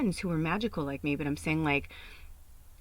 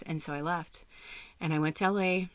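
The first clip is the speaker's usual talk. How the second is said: ordinary delivery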